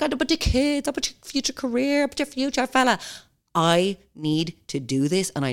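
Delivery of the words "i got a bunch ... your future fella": high, sing-song mock voice; mocking mimicry. Mimicking people who use the kids against her, mocking. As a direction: parrot the objections in a silly high voice.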